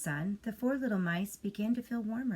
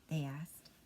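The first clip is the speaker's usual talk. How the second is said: ordinary delivery, nothing marked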